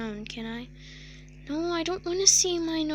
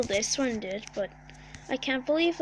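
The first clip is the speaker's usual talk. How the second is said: normal talking voice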